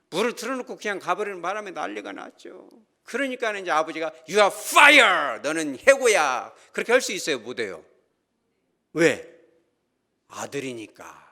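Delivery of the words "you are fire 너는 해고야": loud, drawn-out mock shout; hammed-up firing. Father mock-firing his son over the flood, loud. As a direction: bellow it like an absurd angry father.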